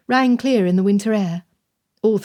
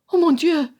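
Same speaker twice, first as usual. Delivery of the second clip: higher, a gasp of alarm; frightened exclamation; 8 semitones higher than usual. A narrator's reading. Madame fearing news of Alex, frightened cry. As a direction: cry out in sudden dread. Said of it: "Her own reflected her fear".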